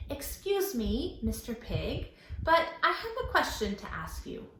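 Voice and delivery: put-on a boy politely asking the pig; polite little boy. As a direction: ask sweetly like a small child.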